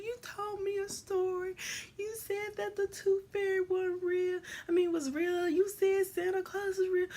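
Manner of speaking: put-on high accusing child; imitating her child self; upset kid voice. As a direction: scold mom like a hurt kid.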